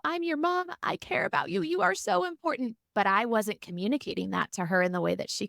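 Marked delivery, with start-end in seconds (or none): 0.1-2.8 high, insistent reassuring mom; pleading reassurance. Retold or imitated. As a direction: insist on your love a little too eagerly. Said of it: "And I would go".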